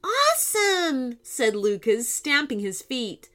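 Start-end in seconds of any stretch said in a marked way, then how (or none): 0.0-1.2 high, drawn-out awesome; thrilled kid shouting awesome; excited. Acted. Boy thrilled by glowing shoes.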